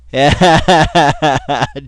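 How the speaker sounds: loud, rapid burst of laughter; loud cackle. Real, not acted. Host laughing at the man in the video, loud cackle. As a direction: cackle loudly in a long burst.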